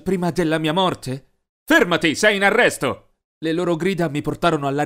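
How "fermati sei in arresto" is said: police shout. Acted. Police burst in.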